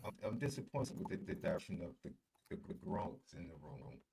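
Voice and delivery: muffled, garbled bad connection; underwater audio. Real, not acted. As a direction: sounds like talking underwater. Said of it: "It sound like your audio is underwater".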